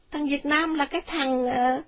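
high-pitched indignant answer; retorting mother. A narrator's reading. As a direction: argue back in a high voice.

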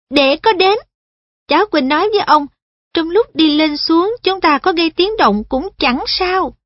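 put-on a polite boy guiding an elder; earnest boy voice. Perrin speaks.

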